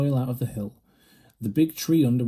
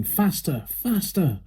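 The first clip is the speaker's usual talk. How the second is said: shouting; 7 semitones higher, slower than usual. A narrator's reading. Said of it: "hopping around ... and shouting".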